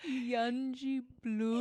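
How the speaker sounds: slow "Yunji Blood", YUN-jee-blood, rising at the end; incredulous mispronunciation. Retold or imitated. Friend disbelieving the DJ's error, "[name]" questioned. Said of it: "He's never pronounced it like that before".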